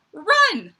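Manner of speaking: urgent. Acted. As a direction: fling the word out like fleeing. Reading step two of panic plan.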